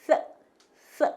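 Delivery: 쓰 as a voiceless th, tongue between teeth; English TH sound. Shown as a demonstration. Teacher asking learners to watch the mouth, 쓰 as th. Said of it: "입을 보세요".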